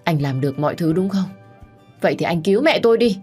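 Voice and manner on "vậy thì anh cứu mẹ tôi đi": higher, quick, bitter plea; urgent, raised-pitch demand; desperate demand. Acted. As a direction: challenge him in grief, rushing. Grieving woman challenges ex-husband.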